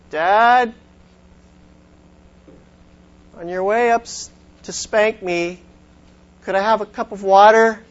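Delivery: put-on high, sweet innocent boy voice. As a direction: ask it as a cheeky little kid. Boy's cheeky punchline request.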